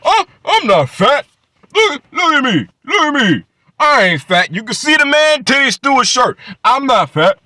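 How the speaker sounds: high, loud whiny mock protest; mocking Angry Man. Mocking a YouTuber who hates being called fat, high and loud. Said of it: "He gets tri".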